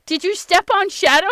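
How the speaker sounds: urgent worry for the cat; wife asks husband in alarm if he stepped on the cat. Retold or imitated.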